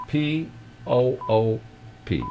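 letters drawn out slowly and high; slow spelling. Acted. Host spelling the hotline name to listeners, slow and high.

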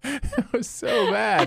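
laughing. Real, not acted. Laughing at the failed chant.

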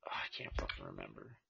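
whispered under the breath. Real, not acted. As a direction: mutter it, barely voiced. Frustrated aside under breath.

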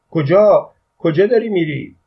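high, loud, startled questioning; flustered shout. A narrator's reading. Father spotting his son leaving, flustered yell. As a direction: shout after someone in a fluster. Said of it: "دست پاچه داد زد".

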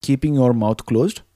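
soft, unhurried guided breathing voice; calm meditative tone. Acted. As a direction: guide them gently through each breath. Leads breathing exercise.

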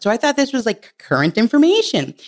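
usual speaking voice

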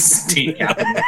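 said through a laugh. Real, not acted. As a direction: laugh while saying it. Laughing quip about the beer.

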